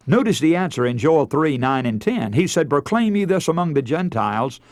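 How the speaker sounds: normal talking voice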